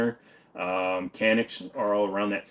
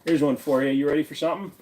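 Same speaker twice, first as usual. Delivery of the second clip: ordinary delivery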